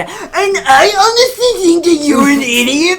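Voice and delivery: loud, high-pitched arguing impression; mock debate shouting. Mimicking two candidates arguing on stage, mock shouting. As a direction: yell over your opponent, hammed-up. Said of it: "the debates were literally like".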